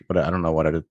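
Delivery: ordinary talk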